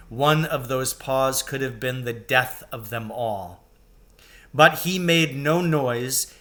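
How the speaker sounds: usual speaking voice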